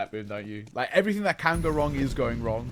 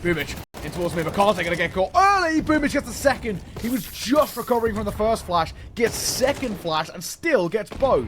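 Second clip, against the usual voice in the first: excited play-by-play, higher and louder; esports caster; 5 semitones higher, 3 dB louder than usual. Acted. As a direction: call each kill as it lands. Live play-by-play of a fight.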